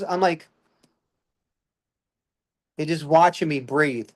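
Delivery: ordinary delivery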